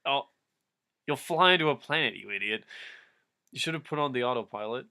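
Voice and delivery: ordinary talk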